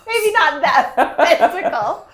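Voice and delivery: laughing through the words. Real, not acted. Laughing at dragon joke.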